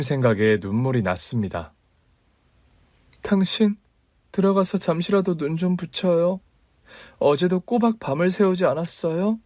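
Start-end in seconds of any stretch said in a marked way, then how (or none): 3.2-9.4 lightly raised gentle wife voice; wife's voice. Acted. Wife voiced by male narrator. (남편을 걱정하는 아내의 조금 높인 목소리)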